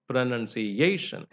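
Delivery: slow pronunciation, weight on the A; stress on A. Shown as a demonstration. Teacher repeats the primary stress, A stressed in pronunciation. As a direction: stretch the A syllable in pronunciation. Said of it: "So A is given importance".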